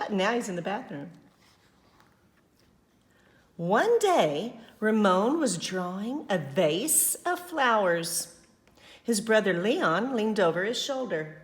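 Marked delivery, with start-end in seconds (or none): 3.6-11.4 put-on lively read-aloud narration for children; storybook reading voice; story narration. A narrator's reading. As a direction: read it out to the class with energy.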